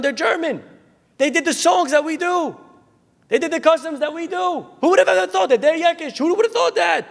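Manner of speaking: very high-pitched, thrilled marveling; acted over-the-moon excitement; astonished mother. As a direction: build to amazed exclamations. Mother marvels at neighbors' customs.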